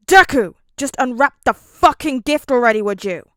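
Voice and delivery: loud furious shout; angry yell. A narrator's reading. Bakugo cutting off Midoriya's muttering, furious shout. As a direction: snap at him to hurry up. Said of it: "Bakugo growled at him".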